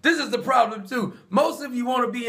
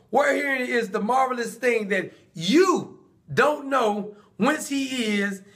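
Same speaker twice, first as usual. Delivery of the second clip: slow pointed answer; slower than usual. Acted. Blind man answers slowly.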